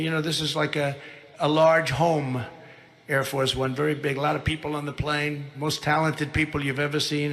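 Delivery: normal talking voice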